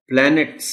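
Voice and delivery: प्लैनेट्स। said loud and clear as a model word; model word planets. Shown as a demonstration. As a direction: present प्लैनेट्स। crisply for the class. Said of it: "ये लफ्ज खास तौर पर आपने बच्चों को बताना है इसका प्रोनाउंसिएशन".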